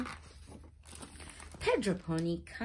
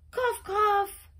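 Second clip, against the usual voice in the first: high, loud play coughs; hammed-up high coughs; mock coughing; 13 semitones higher, 7 dB louder than usual. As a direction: cough like a sick little pony. Sick pony coughing.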